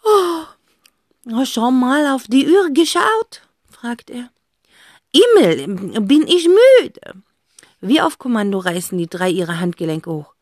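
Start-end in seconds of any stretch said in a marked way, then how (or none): 0.0-7.2 slow, yawning Frenchman; a drowsy fox with French lisp; a hint of a sleepy fox. A narrator's reading. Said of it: "dann gähnt er ausführlich".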